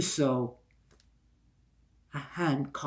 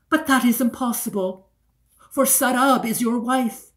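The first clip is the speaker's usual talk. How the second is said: higher, louder, rushing out in disbelief; startled protest; 7 semitones higher, 8 dB louder than usual. Acted. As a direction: blurt it out, shocked. Stunned protest to the prince.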